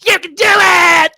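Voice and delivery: high, loud, stretched-out cheer; little girl's cheering yell. Retold or imitated. Little girl cheering on the batter, high drawn-out yell. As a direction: yell encouragement like a kid in the stands. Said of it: "she goes".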